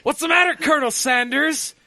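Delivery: high mocking villain voice; Spaceballs villain. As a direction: taunt in a high squeaky voice. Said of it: "that part in Spaceballs".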